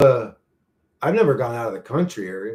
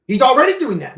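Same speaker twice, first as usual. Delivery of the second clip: angry retort; 10 semitones higher, 8 dB louder than usual. Real, not acted. Repeating an angry point.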